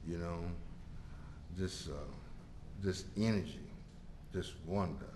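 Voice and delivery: very soft, low, halting wonder; hushed awe. Retold or imitated. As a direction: let the voice sink away in amazement. Relives first hit in awe.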